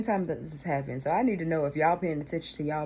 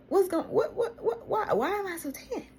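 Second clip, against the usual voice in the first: bewildered self-question. Retold or imitated. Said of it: "So I'm like".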